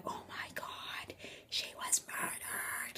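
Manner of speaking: slow, very soft whisper; horrified whisper. Retold or imitated. Friends reacting to the murder story, horrified whisper.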